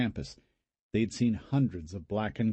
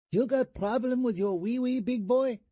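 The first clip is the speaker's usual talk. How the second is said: put-on high falsetto, Vietnamese-accented come-on; falsetto hooker voice. A narrator's reading. Brother teasing brother with a dummy arm, falsetto hooker voice. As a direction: tease him in a squeaky hooker voice. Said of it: "still in his hooker voice".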